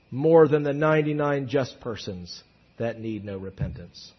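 low-pitched, understated sarcasm; dry sarcasm. Acted. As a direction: read it with quiet irony. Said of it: "the sarcasm".

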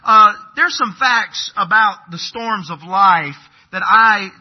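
loud, slow, high pulpit delivery; preaching at full force. Acted. Pastor moving from his story into the sermon, preaching hard. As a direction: drive the point home to the congregation.

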